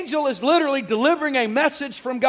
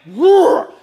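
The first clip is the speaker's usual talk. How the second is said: loud, raised imitation lion roar; 7 semitones higher, 9 dB louder than usual. Preacher showing the weight of the angel's voice, lion roar. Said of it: "This lion".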